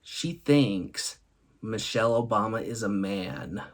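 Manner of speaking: usual speaking voice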